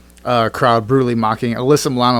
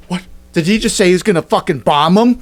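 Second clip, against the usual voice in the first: mock shocked outrage; 7 semitones higher than usual. Mocking Twitter's reaction to Trump, in hammed-up shocked disbelief. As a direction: gasp it out like a scandalised prude. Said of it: "And Twitter went".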